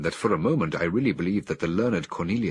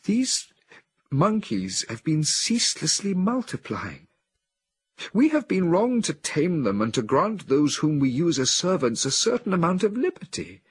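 much higher, louder, urgent voice; a woman in a trance, fearful of the apes; anxious woman; 10 semitones higher, 4 dB louder than usual. A narrator's reading. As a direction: warn of the danger with rising dread. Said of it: "said the anxious voice".

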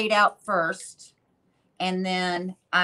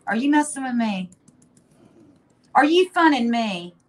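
higher-pitched teasing challenge; playful accusation, pitched up; mock challenge; 4 semitones higher, 3 dB louder than usual. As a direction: needle him with a mock-indignant question. Teasing her partner with his catchphrase.

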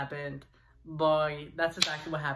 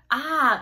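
short high yelp of pain; pained scream; 8 semitones higher, 7 dB louder than usual. Retold or imitated. Boy being hit with a stick screams out in pain. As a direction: cry out as the stick hits. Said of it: "and I'm screaming".

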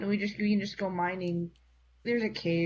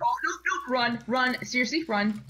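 urgent cries to run; panicked warning, higher and louder; being chased; 5 semitones higher, 5 dB louder than usual. Real, not acted. Five skeletons charging at them.

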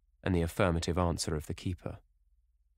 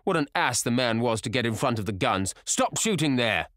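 raised, higher voice building to a shout; calling out loudly; 5 semitones higher, 4 dB louder than usual. A narrator's reading. Sir Geoffrey halting the guns after hitting a beater, calling out. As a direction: call out in exasperation across the shoot. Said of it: "he called out at the top of his voice".

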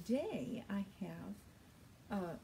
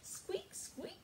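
very high, soft mouse squeak; squeaky mouse; 4 dB quieter than usual. Acted. Storyteller voices Mouse for the children, very high. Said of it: "said Mouse".

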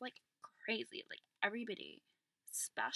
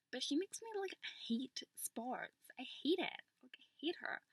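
exasperated complaint; 7 semitones higher, 3 dB louder than usual. Acted. Girl venting to her vlog about her PE teacher, exasperated. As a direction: complain with the pitch pushed up.

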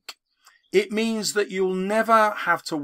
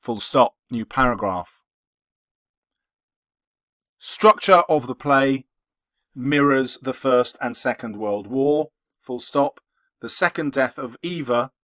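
dictating; 5 semitones lower than usual. Acted. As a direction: spell out each punctuation mark aloud. Dictating an essay into Word.

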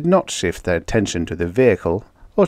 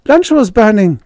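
panting; 13 semitones higher, 7 dB louder than usual. A narrator's reading. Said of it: "she panted".

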